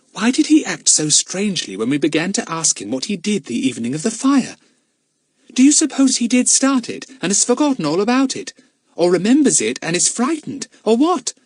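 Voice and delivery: high, animated schoolgirl voice; urgent young detective; puzzled child. Acted. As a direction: wonder aloud in a high, eager child's voice. Plays Daisy puzzling aloud.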